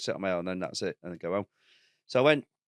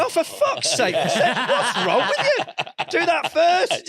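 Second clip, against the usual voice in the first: much higher, slow, laughing mock shout; exasperated outburst; 7 dB louder, much slower than usual. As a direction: blurt it out in laughing disbelief. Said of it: "I went".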